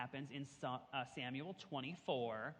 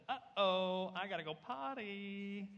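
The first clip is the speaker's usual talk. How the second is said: singsong "uh-oh" with a higher, held last word; child needing potty; 4 semitones higher, 3 dB louder, slower than usual. Acted. Teacher imitating someone on a long road trip, singsong potty plea. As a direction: draw it out in a mock-worried singsong.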